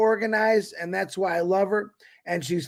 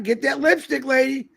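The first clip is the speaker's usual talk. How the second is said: higher-pitched, teasing summons; playful call-out; 6 semitones higher than usual. Real, not acted. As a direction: call her on with a high, playful lilt. Host playfully calling guest on screen.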